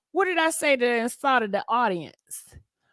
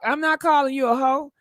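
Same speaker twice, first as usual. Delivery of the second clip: normal talking voice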